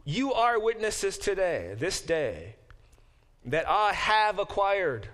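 loud, high, slow public declaration; solemn proclamation. Acted. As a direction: announce it to the whole gate. Boaz proclaims before elders.

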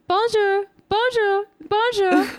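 high, squealing bonjour, bonjour; mock bubbly American girl. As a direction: chirp bonjour like an overeager tourist. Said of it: "he's like".

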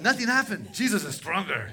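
high, very loud imitation of jubilation; triumphant shout. Convert reporting back to his pastor, triumphant shout. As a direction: belt out the quote in triumph.

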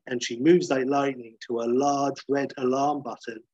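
ordinary delivery

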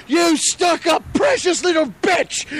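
high, drawn-out mock yell; Gordon Ramsay. Chef finally snapping at a woman, hammed-up shouting. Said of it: "was yelling at him".

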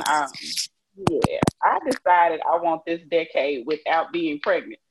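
ordinary talk, nothing marked

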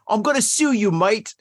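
hammed-up Aussie threat; Australian accent. Shown as a demonstration. Fixing the accent with "mate" tacked on, in mock Australian. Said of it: "it's a wrong accent".

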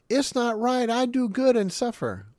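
high, loud indignant whine; whiny complaint. Retold or imitated. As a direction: complain like a wronged child. Voicing a complaining believer.

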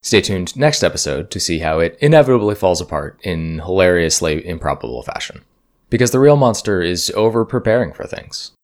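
podcast promo read. Acted. Host reads intermission promo.

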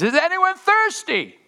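high, loud crying out to the crowd. Acted. Jesus crying out to the crowd, high and loud. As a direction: call out to everyone in the temple. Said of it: "he cries out in a loud voice".

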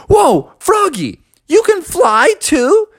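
high, slow astonished cry; amazed eagle. Acted. Eddie seeing Froggy in the air, high slow amazement.

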